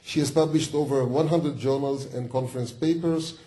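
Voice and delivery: normal talking voice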